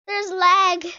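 usual speaking voice

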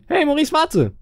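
high-pitched character call; friend calling out. Acted. Friend catching up with Maurice, high calling voice. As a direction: call after someone in a squeaked-up voice.

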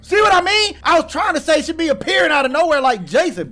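high, loud alarmed complaint; rattled protest. Real, not acted. Gamer after monster appears from nowhere, rattled protest. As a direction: protest loudly, still jolted.

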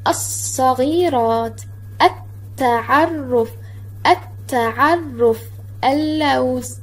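الصغيرات slowly, "as-saghiraat" with a long s; slow teacher's demo of الصغيرات، التعرف، اللوز, doubling the letter after al; silent sun lam. Shown as a demonstration. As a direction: draw out التعرف as "at-ta'arruf", holding the double t. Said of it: "لم تنطق اللام في هذه الكلمات".

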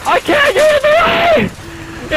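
high panicked yell; high shout. Real, not acted. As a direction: shout it frantically.

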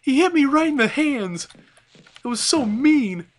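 high, whining imitation of a wounded enemy; mock whiny monster. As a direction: voice the hurt creature as a crybaby. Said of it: "He's like".

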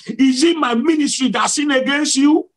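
high-pitched cry to the Lord; retold shout. Preacher retells asking God about his ministry, high shouted plea. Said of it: "I said it the second time".

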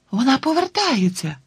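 put-on high excited girl's shout; excited call. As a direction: cry out with joy. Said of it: "схвильовано гукнула Тіна".

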